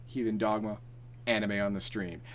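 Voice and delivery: normal talking voice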